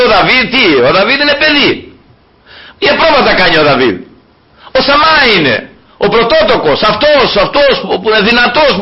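put-on high, laughing scoff at the boy David; dismissive father, laughing. Jesse scoffing at David to Samuel, high laughing disbelief. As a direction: scoff as Jesse, amused that the boy could be chosen. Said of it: "σου λέει".

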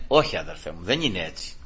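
low, soft, settling answer; calm reassurance. Acted. Preacher answers the panicking brother, calm reassurance. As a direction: drop down and gently reassure.

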